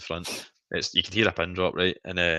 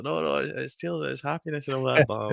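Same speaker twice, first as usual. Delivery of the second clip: lightly put-on choked-up voice; retold tearful explaining; 7 semitones higher than usual. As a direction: imitate explaining through tears to a child. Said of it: "while sobbing into myself".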